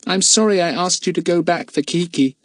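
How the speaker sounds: put-on high-pitched, apologetic boy; humble. A narrator's reading. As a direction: apologise meekly in a young lad's voice. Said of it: "said Jack humbly".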